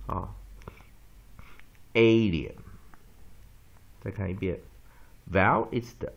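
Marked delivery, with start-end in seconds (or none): 1.9-2.5 loud, long A at the start of "alien". Shown as a demonstration. Teacher repeating the word for the class, long A in "alien". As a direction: model "alien" with a strong first syllable. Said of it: "所以这A要念长母音A".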